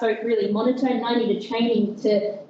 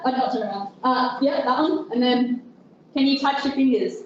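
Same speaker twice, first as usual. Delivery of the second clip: ordinary talk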